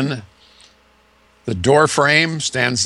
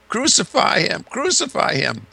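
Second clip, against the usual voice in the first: distant crowd cry; 11 semitones higher than usual. Retold or imitated. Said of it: "and now he hears".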